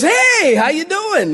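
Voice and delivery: high, bright-eyed "Hey, how you doing?"; overly friendly stranger. Retold or imitated. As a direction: play the eager salesman greeting. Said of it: "he's, like, all friendly".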